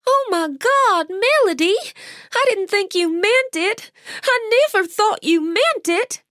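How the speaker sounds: high, panicked pleading; frantic distress. A narrator's reading. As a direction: blurt it out close to tears. Jolene panics, confessing in tears.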